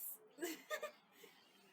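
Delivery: laughing close to tears; tearful laugh. Real, not acted. Streamer laughing tearfully at the end of the song. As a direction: laugh that half breaks into crying.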